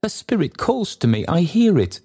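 put-on high, anguished old man's exclamation; Trotty. A narrator's reading. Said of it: "exclaimed the old man".

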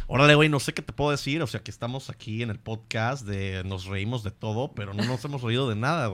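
casual Mexican accent; relaxed Mexican lilt. Shown as a demonstration. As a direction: chat like a Mexican guy. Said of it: "Carlos, mexicano".

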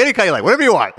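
mock ingratiating. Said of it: "his way of being the most ingratiating".

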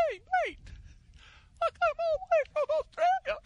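put-on very high, squeaky Australian accent as Messi; a tiny, strangled Australian voice that sounds like drowning. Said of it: "That's Messi if he were Australian".

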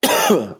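sharp high choking cough. Real, not acted. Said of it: "Sorry, I'm choking here".

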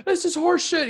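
high, loud mock yell at the ref; mock coach yell. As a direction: shriek it like a coach losing his mind. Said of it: "He's like".

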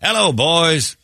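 greeting from the booth. Retold or imitated. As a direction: call down to fans from above. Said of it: "he leaned out to say hello".

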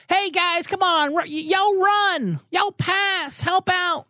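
high, mock sideline yell; hammed-up quarterback shouting to teammates; imitating Russ yelling. Russ yelling to his teammates on film, high mock yell. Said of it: "film of him yelling".

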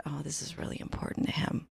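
unvoiced inner whisper. Telling herself to give in to husband, whispered. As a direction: breathe it as a private thought. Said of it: "I looked at him and I said".

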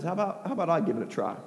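soft, quick, casual offer; quietly volunteer after the uproar; hushed deadpan; understated offer. Acted. Elijah's understated reply after rivals fail.

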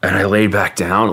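ordinary talk, nothing marked

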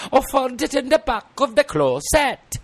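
put-on high Welsh child's voice; a child shows what it found, high and Welsh; little child voice. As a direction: pipe up like a small Welsh kid.

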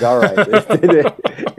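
high, giggly retold giving-in; laughing concession. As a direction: break into laughter while playing along. Laughing at wife's dress code.

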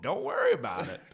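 lightly voiced cagey old prospector; gruff mountain man. Prospector serving boot stew.

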